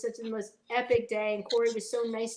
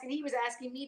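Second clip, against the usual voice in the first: ordinary talk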